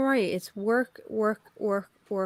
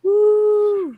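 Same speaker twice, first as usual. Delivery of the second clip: excited woo; 12 semitones higher, 5 dB louder than usual. Real, not acted. Guest cheers on arrival.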